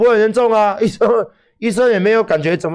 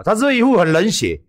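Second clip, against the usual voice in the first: usual speaking voice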